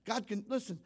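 ordinary talk

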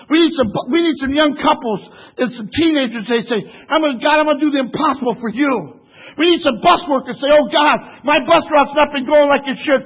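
rising preacher cadence; building sermon. Acted. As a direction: push the church harder line by line.

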